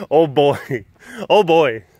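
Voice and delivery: ordinary talk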